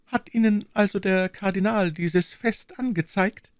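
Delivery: high, alarmed queen calling out; a startled queen crying out; the queen realises the cardinal's hand, alarmed; queen's outcry. A narrator's reading. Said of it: "rief die Königin".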